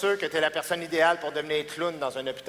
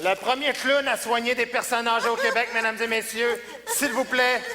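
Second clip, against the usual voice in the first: rising announcer build to the name; arena announcer; 5 semitones higher, 4 dB louder than usual. Acted. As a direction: introduce the act like a show host. Introduces act to audience.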